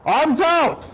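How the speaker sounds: shouting command. Real, not acted. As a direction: shout the order across the lot. Officer orders downed suspect.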